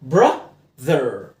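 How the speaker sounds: براذر split slowly as "bruh... therr"; slow, low-falling syllables of براذر; TH sound. Shown as a demonstration. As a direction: stretch براذر in two parts with voiced TH. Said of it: "تي اتش بنطقها ذ".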